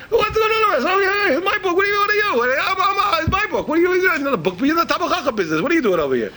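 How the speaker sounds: high, loud mock-indignant protest; indignant outburst. Book owner to scholarly visitor, "it's my book", flustered indignation. Acting out his own indignant bafflement.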